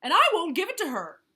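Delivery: high, fast, loud yell. A narrator's reading. Vulture refuses in front of Lion, yelling. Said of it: "yelled Vulture".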